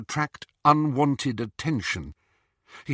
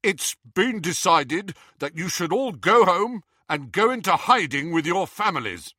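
higher, louder announcement voice; pitched-up official instruction; Harry addressing a group; 7 semitones higher, 4 dB louder than usual. Acted. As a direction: tell the group firmly what's decided.

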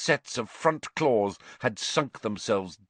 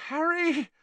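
whimpered. A narrator's reading. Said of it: "Hermione whimpered".

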